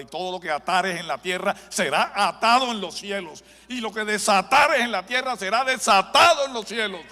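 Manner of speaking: booming, rising proclamation of the verse; loud scripture proclamation. Real, not acted. Preacher proclaiming scripture to the congregation, booming. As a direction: proclaim the verse at full force.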